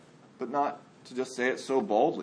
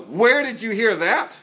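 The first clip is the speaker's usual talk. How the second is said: sharp, higher, louder mock alarm; startled parent's accusing question; 6 semitones higher, 6 dB louder than usual. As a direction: snap it back like a shocked parent. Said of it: "don't go".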